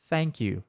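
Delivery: "thank you" com língua entre os dentes no TH, mais suave; TH correto. Shown as a demonstration. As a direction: mostra o som TH de "thank you" com clareza. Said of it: "esse som aqui, ó". ("thank you" com TH interdental)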